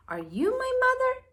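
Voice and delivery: high, quick little bird voice; baby bird. Acted. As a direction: asks the dog hopefully. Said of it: "he asked the dog".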